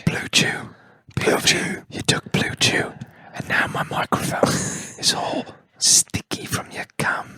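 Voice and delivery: breathy ASMR whisper. Pretend. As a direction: whisper it close to the mic.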